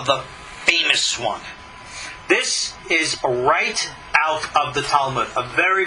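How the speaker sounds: usual speaking voice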